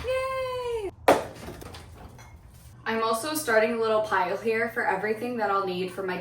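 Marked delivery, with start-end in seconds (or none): 0.0-0.9 excited. Real, not acted. Happy about going to boyfriend's.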